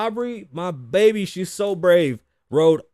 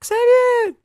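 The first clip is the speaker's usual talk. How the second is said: much higher, louder mock call of his name; imitating a fan calling out; 8 dB louder than usual. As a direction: call out like a random woman spotting him. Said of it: "you will hear a random woman's voice go".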